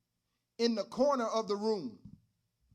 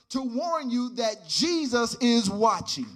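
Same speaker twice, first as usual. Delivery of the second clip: louder, rising preacher's cadence; preaching at full force; 3 semitones higher, 7 dB louder than usual. Acted. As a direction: drive it home to the congregation.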